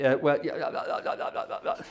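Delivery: flustered stammering yeahs fading to a breathless whisper; Hugh Grant impression. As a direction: bumble awkwardly, trailing off into air. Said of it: "doing a Hugh Grant".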